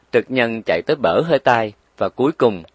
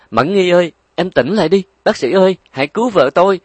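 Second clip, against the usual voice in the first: loud high panicked scream; 8 semitones higher, 6 dB louder, faster than usual. Acted. Husband screaming for the doctor as his wife collapses. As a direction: scream for help as she faints. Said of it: "gào lên".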